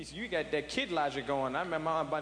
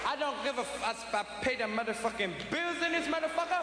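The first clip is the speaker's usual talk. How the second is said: put-on drunk father; 5 semitones higher than usual. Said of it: "stand up ... and say".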